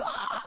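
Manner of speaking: small pretend shriek; imitated little scream. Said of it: "like little screams from people".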